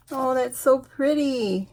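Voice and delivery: ordinary talk, nothing marked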